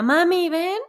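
put-on high little girl calling out for mom; child calling. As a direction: call out like a sleepy kid. (niña llamando a mamá)

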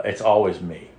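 usual speaking voice

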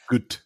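"good" with a fronted Scottish vowel. Shown as a demonstration. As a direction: mimic her Scottish "good". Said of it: "She says".

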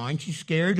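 ordinary delivery

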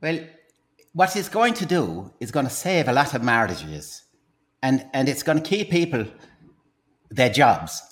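salesman pitch in a put-on Irish accent; stage Irishman with a secret to share; Irish accent, pitching his invention to the host.

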